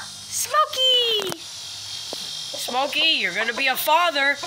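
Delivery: high, loud call to the dog; excited news. Real, not acted. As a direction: announce it to the dog with glee.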